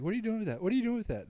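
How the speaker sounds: high-pitched mock-alarmed child; alarmed kid. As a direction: imitate a worried kid narrating the shot. Child's panicked questions retold.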